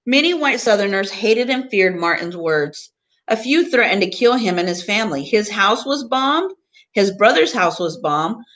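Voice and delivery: expressive picture book reading; reading to children. Acted. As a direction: read the book to the class with gentle emphasis. Teacher reads picture book aloud.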